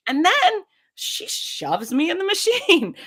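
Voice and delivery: high, giggling as she tells it; laughing through it. Real, not acted. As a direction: laugh out the memory of being shoved in. Laughing while recounting MRI.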